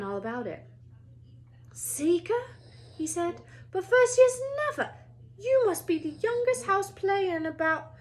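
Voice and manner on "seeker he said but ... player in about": much higher, loud astonishment; stunned boy at dinner, high and excited; Ron amazed. Acted. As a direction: voice cracking with excitement. Ron voiced in story.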